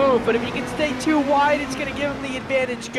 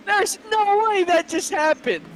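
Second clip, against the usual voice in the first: stunned disbelief; 8 semitones higher, slower than usual. Real, not acted. Photo finish reaction.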